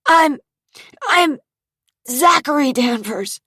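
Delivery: high, loud, stammering plea; desperate stammer. Acted. Beaten Zach stammers his name to the thugs, desperate. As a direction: force the name out through pain.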